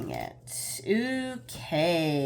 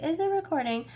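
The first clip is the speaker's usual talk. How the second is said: anxious self quote; 10 semitones higher, faster than usual. Retold or imitated. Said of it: "to be like".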